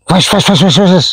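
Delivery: rapid whooshing "wes" repeated in a run; imitated bird noise. Shown as a demonstration. Showing the sound near the palm trees, imitated whooshes. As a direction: imitate noisy wings cutting the air. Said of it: "itu suka bunyi".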